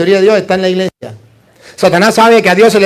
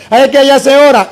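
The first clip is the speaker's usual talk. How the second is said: a hint of a higher, whiny objector; mock objector; 5 semitones higher, faster than usual. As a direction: lightly voice someone making excuses. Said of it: "¿Qué voy a hacer en la secta protestante?".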